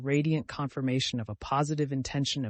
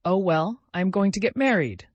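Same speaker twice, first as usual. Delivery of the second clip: exasperated answer, louder and higher; vexed; 7 semitones higher, 8 dB louder than usual. A narrator's reading. Vasya telling his pestering friend the news, vexed. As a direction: blurt it out in mild exasperation. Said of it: "Vasya answered with vexation".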